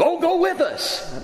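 a high, eager burst of delight; excited Moses. Retold or imitated. Moses overjoyed that God will come, high and eager. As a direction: jump on the promise like a thrilled child. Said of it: "He's just so excited".

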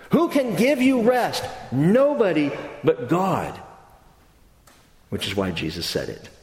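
ordinary delivery

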